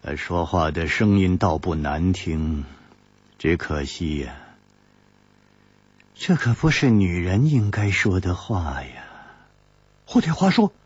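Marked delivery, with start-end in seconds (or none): 0.0-9.0 low, cool and detached; woman coolly needling her companion; coolly indifferent. A narrator's reading. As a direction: say it flatly, hiding the jealousy. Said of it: "才淡淡地说".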